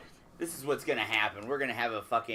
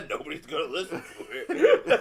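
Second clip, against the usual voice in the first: loud, higher voice through laughter; laughing; 6 semitones higher, 6 dB louder than usual. Real, not acted. Co-host ribbing friend's podcast idea, laughing. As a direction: crack up while saying it.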